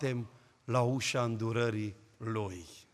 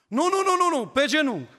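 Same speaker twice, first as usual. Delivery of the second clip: much higher, louder repeated refusal; old man insisting; 12 semitones higher, 10 dB louder than usual. Retold or imitated. Old man refusing the tired guest, loud high insistence. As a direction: insist they kneel right now.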